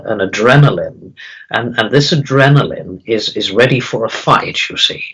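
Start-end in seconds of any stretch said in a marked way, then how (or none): none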